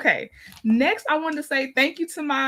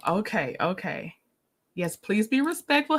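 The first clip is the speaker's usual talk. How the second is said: ordinary talk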